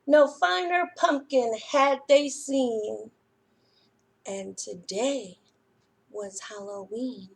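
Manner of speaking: lively, expressive storytime read-aloud; reading to children. A narrator's reading. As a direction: read it like a librarian to little kids. Reads picture book to children.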